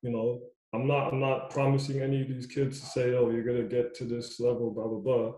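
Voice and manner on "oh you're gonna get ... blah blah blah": normal talking voice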